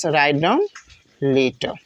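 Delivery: words stretched very slowly; slow classroom dictation. Shown as a demonstration. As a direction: dictate the heading for the class to copy. Teacher dictating a heading.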